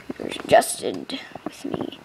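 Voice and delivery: whispered aside; hushed, voiceless whisper. Real, not acted. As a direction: breathe it out close to the mic. Sitting close to loud computer.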